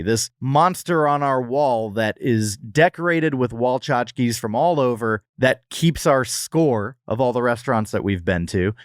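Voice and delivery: ordinary talk, nothing marked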